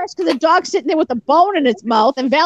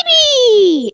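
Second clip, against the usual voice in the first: put-on high, loud squeal of "Baby!"; high-pitched; 9 semitones higher, 4 dB louder than usual. Shown as a demonstration. Showing friends how to call a dog, high-pitched. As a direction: coo "Baby!" way up high, drawn out. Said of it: "it's the high pitch".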